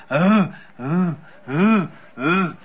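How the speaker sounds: low moans imitating haunted women; lightly imitated ghostly moaning; mournful moaning. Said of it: "and they were going".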